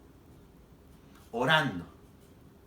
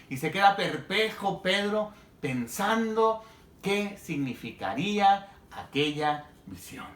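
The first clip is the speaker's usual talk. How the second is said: ordinary delivery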